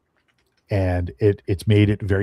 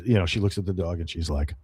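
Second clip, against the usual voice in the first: normal talking voice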